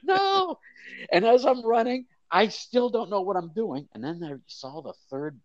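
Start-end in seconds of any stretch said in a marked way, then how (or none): 0.0-0.6 high, loud mock cry of alarm; imitating a frantic crowd, much higher; panicked fans. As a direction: cry out like a horrified fan in the stands. Fans panicking at chicken on field.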